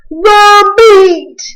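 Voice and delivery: high, loud crew roar; rowdy pirates roaring for meat. A narrator's reading. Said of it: "we all roared".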